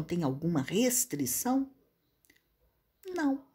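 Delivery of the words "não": bare one-word no; curt and dry. Retold or imitated. Former employer giving the recruiter a curt monosyllabic no. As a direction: shut the question down in one word. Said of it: "Seco, monossilábico".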